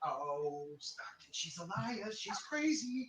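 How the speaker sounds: hushed, urgent aside; whispering. Acted. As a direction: whisper it so mom can't hear. Whispering mom is lying.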